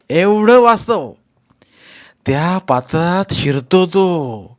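drawn-out mock admiration; imitating awed fans. Actor mimicking audiences praising his play at home, awed. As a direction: act out gushing, amazed theatre-goers. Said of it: "जाऊन सांगतात".

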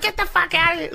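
high furious yell at her son; angry mom shout. Retold or imitated. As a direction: screech at him to get out. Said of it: "she was like".

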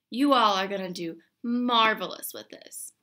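ordinary talk, nothing marked

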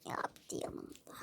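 slow hushed whisper. Acted. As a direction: whisper so as not to wake it. Quiet remark, animal asleep.

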